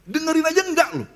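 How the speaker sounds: "Dengerin aja enggak" pitched high, falling at the end; emphatic repeat. Real, not acted. (diulang dengan nada tinggi penuh penekanan)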